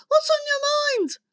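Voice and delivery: high, piping phone voice. Acted.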